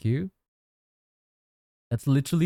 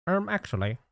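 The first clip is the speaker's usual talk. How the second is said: higher smug "um, actually"; nerd voice; 8 semitones higher, 3 dB quieter than usual. Pretend. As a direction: play the pedantic nerd. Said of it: "Whenever I do the nerd voice".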